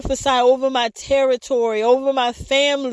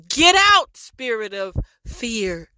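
commanding rebuke. Real, not acted. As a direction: cast it out with authority. Rebuking a spirit in prayer.

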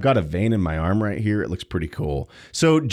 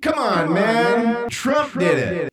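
mock president; 12 semitones higher, slower than usual. Acting out the president's reply.

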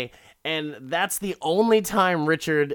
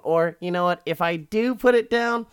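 high, loud taunting condition; acted-out defiant outburst; mock threatening; 7 semitones higher, 7 dB louder than usual. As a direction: raise it like an unhinged threat. Acted threat, refusing to disarm.

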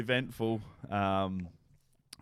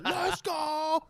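excited cheer. Retold or imitated. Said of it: "She's like".

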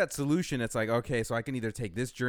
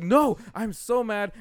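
slow, louder, higher frustrated whine; frustrated refusal; 7 semitones higher, 7 dB louder, much slower than usual. Retold or imitated. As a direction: drag it out, fed up. Refusing partner, retold frustration.

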